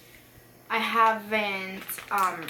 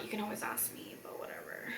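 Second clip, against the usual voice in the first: whispering; 11 dB quieter, faster than usual. Real, not acted. Trailing off, dismissive aside.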